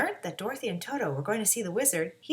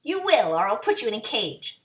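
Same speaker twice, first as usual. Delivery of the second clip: witch threat; 6 dB louder than usual. A narrator's reading.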